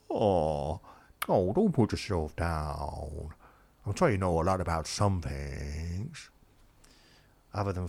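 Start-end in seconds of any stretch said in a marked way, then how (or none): 0.1-6.3 slow, drawn-out put-on comforter voice; hammed-up pep talk in a silly voice; mock consoling. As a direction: console with exaggerated, dopey sympathy. Plays someone consoling the speaker.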